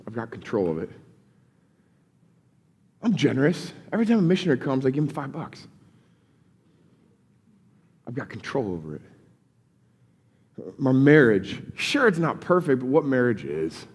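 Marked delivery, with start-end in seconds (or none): 0.1-0.9 low, quiet, self-satisfied; smug self-assurance. Retold or imitated. Believer telling himself he has it handled, quiet and smug. 8.1-9.0 low, quiet, self-satisfied; smug self-assurance. Retold or imitated. Believer telling himself he has it handled, quiet and smug.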